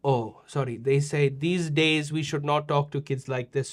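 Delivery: ordinary delivery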